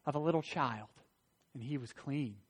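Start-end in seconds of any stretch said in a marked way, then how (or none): none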